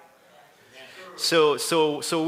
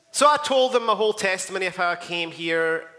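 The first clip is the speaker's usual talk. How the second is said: ordinary delivery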